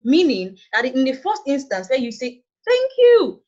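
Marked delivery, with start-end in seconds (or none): 2.7-3.4 high, loud, thrilled thank-you; excited thanks. Shown as a demonstration. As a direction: burst out with delighted gratitude. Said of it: "it shows excitement".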